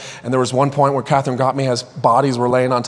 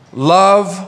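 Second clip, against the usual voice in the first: love sung out high and loud; emphasized love; 5 semitones higher, 5 dB louder than usual. Acted. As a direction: lift the word love up and out. Reading the command from scripture.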